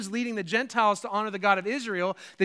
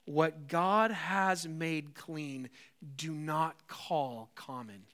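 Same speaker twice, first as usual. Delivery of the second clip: very slow, lower and softer; grave divine voice; 5 semitones lower, 6 dB quieter, much slower than usual. Acted. God answering Peter's refusal, very slow and hushed. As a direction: let each word fall with weight.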